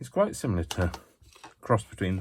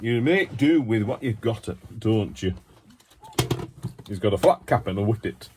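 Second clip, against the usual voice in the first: hammed-up Yorkshire accent, higher pitch; 3 semitones higher than usual. Artist poking fun at making do, mock Yorkshire accent. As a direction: put on a badly faked broad Yorkshire. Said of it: "Yorkshire accent".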